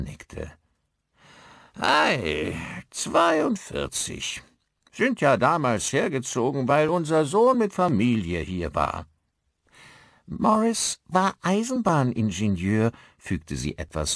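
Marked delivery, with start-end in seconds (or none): 1.8-4.4 put-on very high, slow old-man voice; slow, high character drawl; old villager. A narrator's reading. Old husband confirms. 4.9-9.1 put-on high character voice of an elderly local; pitched-up village local; old villager. A narrator's reading. 10.3-12.9 high-pitched grumpy woman's voice; high character voice; old village wife. A narrator's reading.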